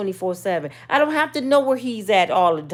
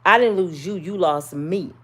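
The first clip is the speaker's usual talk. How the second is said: ordinary talk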